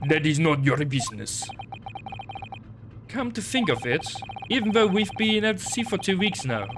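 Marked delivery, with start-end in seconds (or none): 0.1-1.5 gruff Russian sailor, proud; broken English with a heavy Russian accent. Acted.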